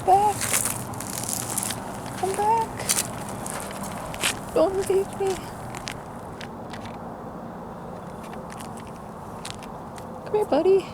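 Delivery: high coaxing pet-talk voice; holding down a pet. Real, not acted. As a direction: call the animal back sweetly, up high. Coaxing a deer to come back.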